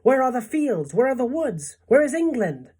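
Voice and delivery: high, loud, bewildered questions; dismayed cries. A narrator's reading. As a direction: call out each question in rising alarm. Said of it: "they cried".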